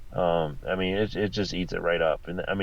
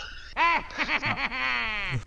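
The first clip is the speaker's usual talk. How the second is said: warrior laugh; 5 dB quieter than usual. Pretend. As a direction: let out a big warrior laugh. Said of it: "where's the warrior laugh?".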